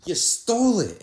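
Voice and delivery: high, loud disbelief; incredulous outburst. Retold or imitated. To his friend who calls theft free, loud disbelief. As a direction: shoot back in total disbelief.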